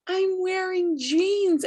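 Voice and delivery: high, drawn-out excited cry; thrilled. Retold or imitated. Reliving her camera moment of fitting into jeans, thrilled.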